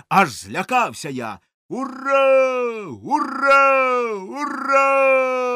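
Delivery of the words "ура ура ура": high, loud triumphant cheer; crowd cheering. A narrator's reading. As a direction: roar it like a crowd at a parade. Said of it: "закричали".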